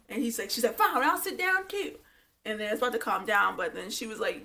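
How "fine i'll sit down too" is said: high, hammed-up grudging retort; mock huffiness. Agreeing to sit down too, mock huffy.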